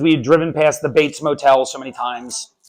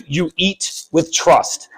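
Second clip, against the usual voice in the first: ordinary talk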